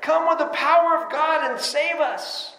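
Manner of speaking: high, ringing crowd praise; crowd acclaim. Retold or imitated. Preacher voicing the crowd welcoming Jesus, high ringing praise. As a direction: lift it like a cheering crowd. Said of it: "they're singing this out".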